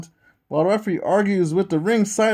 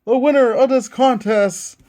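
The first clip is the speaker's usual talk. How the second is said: hyped play-by-play call; wrestling commentator; 6 semitones higher, 4 dB louder than usual. Acted. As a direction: call the match like a ringside announcer. Calls the finish of match.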